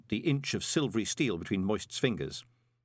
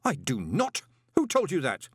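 higher, indignant denial; snapped; 6 semitones higher, 4 dB louder than usual. A narrator's reading. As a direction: snap back, stung by the accusation. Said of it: "snapped Moist".

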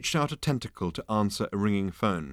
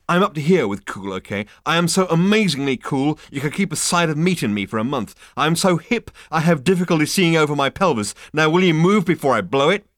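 loud, higher swaggering rant; boastful ranting Zaphod; 7 semitones higher, 7 dB louder than usual. Acted. Zaphod ranting at the receptionist about how cool he is, loud. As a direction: brag and threaten at once.

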